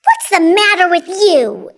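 high, loud angry screech. A narrator's reading. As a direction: screech it at a child who has stopped working. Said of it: "Aunt Spiker screeched".

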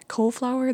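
"Cauliflower" said as COLE-flower; rejected pronunciation. Shown as a demonstration. Vlogger rejecting the video's version, Cauliflower as Cole-flower. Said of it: "that's just not right".

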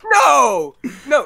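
loud high cry of no; shouted protest. Real, not acted. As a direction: yell it in a panic. Panicked protest to editing.

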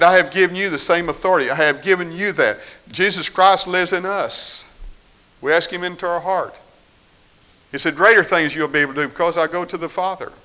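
loud, rising pulpit proclamation; fervent preaching. Acted. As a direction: proclaim it to the congregation. Preaching at full force.

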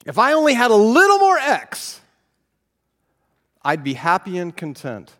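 high, loud whining wish; whiny wishing. Pretend. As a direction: wail the wish like a spoiled child. Repeats whiny wish, louder.

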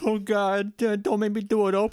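high, loud, pleading cartoon whine. Chips begging Ope, high loud mock whine. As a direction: beg in a silly high voice. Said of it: "It's like".

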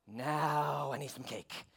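soft, drawn-out longing; mock craving. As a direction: stretch it out slowly, hushed. Acting out Toad craving cake.

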